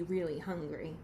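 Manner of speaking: ordinary delivery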